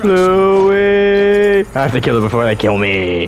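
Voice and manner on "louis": long drawn-out cry of the name; calling out. Real, not acted. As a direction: holler it, stretching the vowel.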